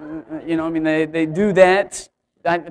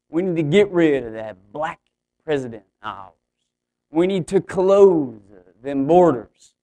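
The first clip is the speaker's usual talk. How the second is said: put-on caricature Oklahoma accent; 2 semitones lower, 3 dB louder than usual. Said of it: "evidently that's a caricature of people from Oklahoma".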